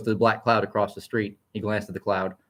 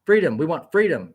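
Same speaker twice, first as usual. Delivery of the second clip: loud, higher cry for freedom; scream; 7 semitones higher, 4 dB louder than usual. A narrator's reading. Woman cries out for freedom as sirens start, loud. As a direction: scream it out, desperate. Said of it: "she screamed".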